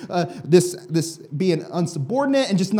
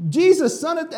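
high, louder cry cut off; high calling out; 10 semitones higher, 4 dB louder than usual. Retold or imitated. Blind beggar shouting over the crowd, high and loud. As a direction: shout to Jesus again. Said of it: "Somebody is shouting".

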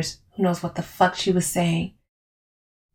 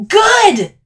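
high, loud defiant shriek; 7 dB louder than usual. A narrator's reading. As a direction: shriek it right over them. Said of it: "I shrieked".

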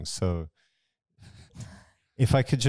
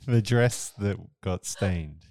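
usual speaking voice

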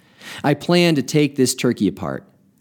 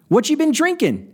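a lightly voiced old man, high and eager; curious old man. A narrator's reading. Old man asking the drunk about his drink, high curious voice. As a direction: ask with sparkling curiosity. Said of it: "his eyes sparkling with interest".

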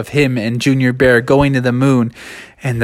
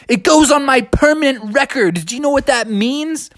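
fretting Bubby; 12 semitones higher than usual. Acted. Bubby worried about record.

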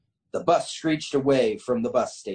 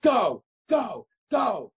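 high-pitched mild mock scream; hammed-up urging, raised pitch; narrated screaming; 11 semitones higher, slower than usual. A narrator's reading. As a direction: separate urgent cries, not full volume. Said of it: "were screaming".

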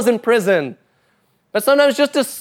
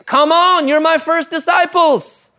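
higher and louder, inviting call; warm call; 10 semitones higher, 4 dB louder, slower than usual. Retold or imitated. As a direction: call them in with open arms. Jesus calling women disciples.

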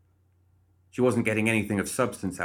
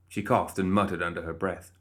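usual speaking voice

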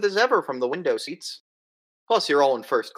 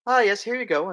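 stewardess voice; 9 semitones higher than usual. Retold or imitated. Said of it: "stewardess ... is just like".

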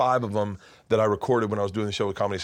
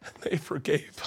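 real choking up. Real, not acted. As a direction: voice breaking high with tears. Teller breaks up over own story.

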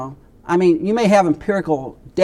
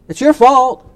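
higher and louder, hammed-up accusation; mock blaming shout; 8 semitones higher, 9 dB louder, slower than usual. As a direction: fling the blame like a petty quarrel. Acted quarrel, blame shifting.

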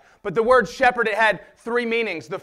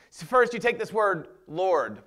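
usual speaking voice

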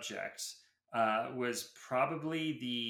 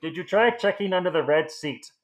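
loud muffled announcement through cupped hand; cupped hand intercom; 5 semitones higher, 11 dB louder than usual. Pretend. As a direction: call it out like a school intercom, hand over mouth.

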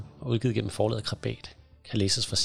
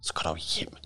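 hushed, low whispered retort; giggly whisper; 4 semitones lower, 6 dB quieter than usual. Acted. Whispering back to Dines behind a little giggle, whispered. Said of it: "hvisker jeg, skjult bag et lille fnis".

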